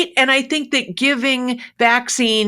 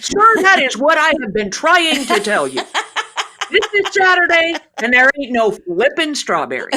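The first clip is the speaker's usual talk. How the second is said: higher, louder, laughing punchline; mock exasperated outburst; 7 semitones higher, 5 dB louder than usual. Pharmacist finally snaps at the customer, mock exasperated outburst. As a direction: let the frustration burst out with a laugh.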